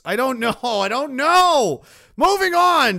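building, high exasperated yell; exasperated mock shout. Streamer dismissing a gross viewer question, exasperated mock shout.

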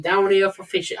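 lightly done West Country farmer; a touch of West Country burr; West Country accent. Shown as a demonstration. Showing his co-host how a Cornish farmer says it, West Country. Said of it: "it's like West Country".

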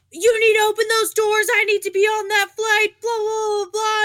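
high, loud demanding yell; imitating an angry old passenger shouting; angry old man. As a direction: demand they open the doors. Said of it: "This old guy's like".

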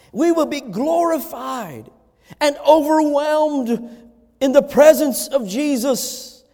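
measured, weighty pulpit delivery; emphatic preaching. Real, not acted. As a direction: preach it with drawn-out key words.

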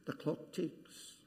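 slow, whispered clock ticking; hushed suspense. Acted. Preacher hushes the congregation to mark time, slow whisper. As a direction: hold it still and whisper.

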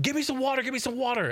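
high-pitched mock yell for water; retold frantic shout, pitched high; imitated yelling. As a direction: call out urgently, pitch way up, without much volume. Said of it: "he's yelling".

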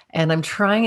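ordinary talk

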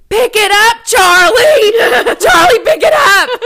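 high, full-volume frantic scream. Acted. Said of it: "So I'm screaming".

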